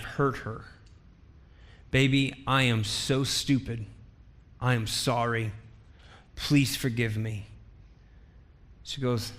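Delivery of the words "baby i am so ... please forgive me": low, hushed retold apology; remorseful plea. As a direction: beg forgiveness softly and low. Husband begs hurt wife's forgiveness.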